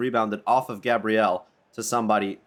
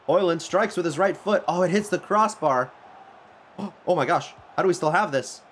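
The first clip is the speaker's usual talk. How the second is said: excited; 6 semitones higher than usual. Real, not acted. Shot hits the crossbar.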